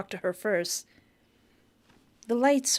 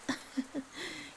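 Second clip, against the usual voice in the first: soft, high little chuckle; a quiet acted laugh, pitched up; woman's laugh; 8 semitones higher, 10 dB quieter than usual. A narrator's reading. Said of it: "The woman laughs".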